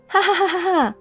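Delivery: high mocking ha-ha laughter; gloating laugh. Ex-wife laughing at her ruined ex, high mocking laugh. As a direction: cackle with cruel delight. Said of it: "I can't stop laughing".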